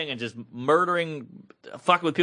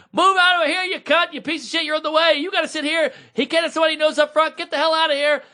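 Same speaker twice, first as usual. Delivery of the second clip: bossy coordinator; 12 semitones higher than usual. Retold or imitated. Audience coordinator ordering people out of the way, mock barking. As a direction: shove people aside with your voice.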